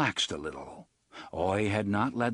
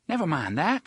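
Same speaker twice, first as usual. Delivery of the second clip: brisk, raised and impatient; 7 semitones higher, 4 dB louder than usual. Acted. Susanna brushes off Eddie's joke, impatient. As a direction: cut him off, no time for jokes. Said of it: "Susanna said impatiently".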